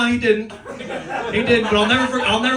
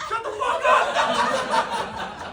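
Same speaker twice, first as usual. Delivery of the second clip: laughing shout; 3 dB quieter, much slower than usual. Real, not acted. Joking shout at friend.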